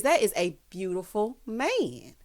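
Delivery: slow, drawn-out praise; slow swooning gush; dreamy admiration. Real, not acted. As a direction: savour every word of it. Admiring him, slowed down.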